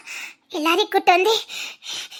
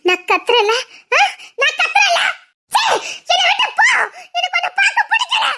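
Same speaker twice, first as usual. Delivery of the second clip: hysterical laughing-crying shout; 8 semitones higher than usual. Acted. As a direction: scream him away, laughing and crying. Hysterical outburst at husband. (கணவனை விரட்ட அழுகையும் சிரிப்புமாக கத்தல்)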